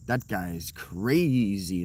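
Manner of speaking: slow, voiced as a stranger; a light touch of an onlooker; mock passerby. Voicing what strangers say about his look, slow. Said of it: "I cultivate a".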